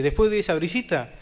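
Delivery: normal talking voice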